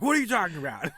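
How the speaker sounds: high, loud, half-laughing protest; mock defensive outburst; mad retort. Mad player to his wife, indignant protest. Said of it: "you're kinda mad, so you're like".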